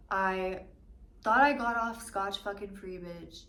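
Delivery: ordinary talk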